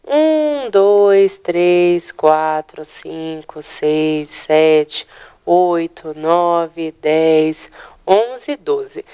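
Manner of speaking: each number set apart in a steady count; slow classroom count, one number per beat; counting syllables aloud. Shown as a demonstration. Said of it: "acompanhem comigo".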